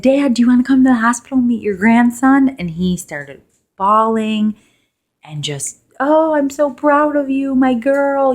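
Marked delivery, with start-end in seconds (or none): 0.0-2.5 high, loud happy invitation; excited news. Retold or imitated. New mother phoning her dad with joy, high and loud. 6.0-8.3 high, loud tearful dad; proud father. Retold or imitated. Father crying on the phone to his daughter, high and loud.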